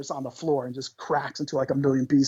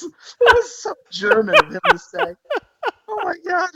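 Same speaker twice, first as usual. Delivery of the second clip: louder and much higher, cracking up; laughing loudly; 7 dB louder than usual. Real, not acted. Storyteller cracking up at the fan's reply, laughing. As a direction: laugh through the whole line.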